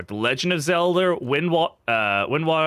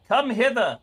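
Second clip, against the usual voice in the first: mock archaic summons; 9 semitones higher than usual. Calling the phone charger over.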